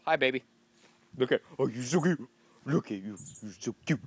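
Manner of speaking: cooing at a pet. Real, not acted. Talking to a pet or baby.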